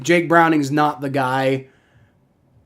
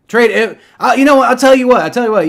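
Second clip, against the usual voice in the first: suddenly much higher and louder; excited outburst; 8 semitones higher, 7 dB louder than usual. Real, not acted. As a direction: burst out at the chat's offer. Reacting to chat trade offer.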